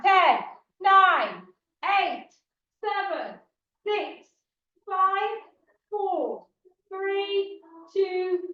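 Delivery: loud, high fitness cueing; aerobics instructor. Acted. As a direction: drive the class through the reps. Leads a workout, counting down.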